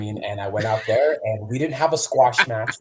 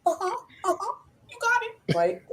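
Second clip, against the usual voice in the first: tiny, very high yes-man voice; eager agreement. Retold or imitated. Young lad agreeing to advice he doesn't understand, meekly eager. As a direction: squeak out mock compliance.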